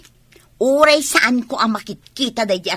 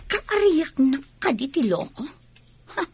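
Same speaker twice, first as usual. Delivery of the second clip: ordinary talk, nothing marked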